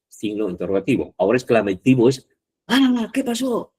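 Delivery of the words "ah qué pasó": high, drawn-out mock cry; demonstrated exclamation. Shown as a demonstration. Teacher showing what exclamatives are, crying out in high exclamation. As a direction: exclaim it slowly, pitched way up, as a classroom example. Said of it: "estoy exclamando".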